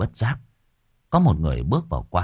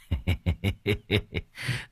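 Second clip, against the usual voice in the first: smug chuckle; 7 dB quieter than usual. Acted. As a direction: chuckle over the cash. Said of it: "Tùng Sẹo cười hình hạch".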